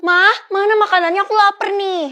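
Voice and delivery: loud, high complaint to mom; hungry child's demand. Acted. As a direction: call out for food like an impatient child. Hungry child demands food from mother.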